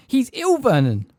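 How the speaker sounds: high, loud panicked shriek for Vernon; a frightened mother calling her husband. A narrator's reading. Petunia panicking.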